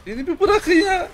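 very high, loud protest; real high-pitched gamer complaint; exasperated plea. Real, not acted. Protesting attackers in game.

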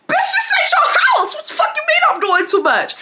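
high, mock-outraged yell; outraged shout. To Wesley at the door, outraged high shout. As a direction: shriek it back at the pushy guy.

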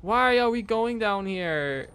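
high, drawn-out wail of protest; long, reluctant moan of dread; dreading whine. Real, not acted.

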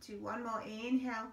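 calm, steady breathing cues; guided meditation. Acted.

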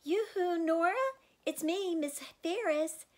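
high, loud sing-song yoo-hoo; calling out. Acted. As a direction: call cheerily through the house. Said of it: "opened the front door and called".

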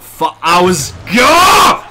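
real gamer rage; frustrated scream. Real, not acted. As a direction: shout rising to a high, loud yell. Screams at the game.

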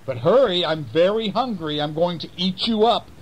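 high, greedy weasel voice; high hungry weasel threatening mouse soup. Acted. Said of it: "And the weasel said".

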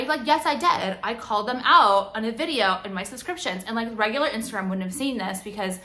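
normal talking voice

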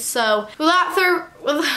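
tripping over the words and breaking off; tongue-tied stumble. Real, not acted. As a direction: botch the phrase midway, about to laugh. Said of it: "she also can't speak English".

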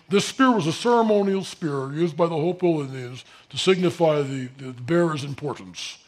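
dry, official voice reading the placard; mock museum label. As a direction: mimic a stiff museum caption.

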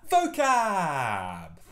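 loud, drawn-out "vocab"; segment announcement. Pretend. Teacher moves on to vocab, loud drawn-out announcement. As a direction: call out the segment title, stretching it.

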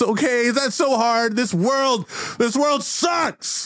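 high, whiny rant at everyone; hammed-up wailing frustration; pirate tantrum. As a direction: let a childish meltdown pour out high. Captain's tantrum goes on.

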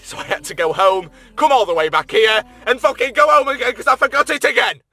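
high, loud exasperated shouting; real worked-up frustration; frustrated rant. Real, not acted. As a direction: rant it, climbing into a yell. Ranting over forgotten battery.

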